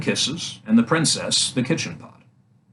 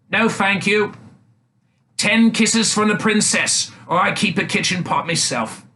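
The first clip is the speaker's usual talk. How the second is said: swineherd's voice; 8 semitones higher than usual. A narrator's reading. Swineherd refuses.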